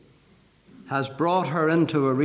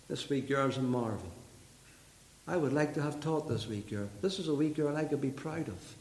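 tender admiration; 2 semitones lower, 8 dB quieter than usual. Real, not acted. Preacher confiding he would love to have reared her, tender admiration.

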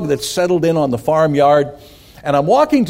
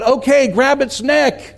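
high, drawn-out acted call; mock holler, pitched way up; 10 semitones higher, slower than usual. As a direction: play the uncle calling instructions from afar. Uncle calls down into the ditch.

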